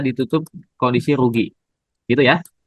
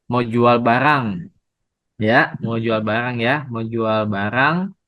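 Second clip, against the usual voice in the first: spelled out slowly, leaning on "jual"; slow emphasis; slower than usual. Shown as a demonstration. Teacher to Bapak Ibu, slowly stressing the example. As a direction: lay "mau jual barang" down slowly for the class.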